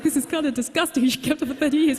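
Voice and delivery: amused disgust bubbling up; laughing disgust. Retold or imitated.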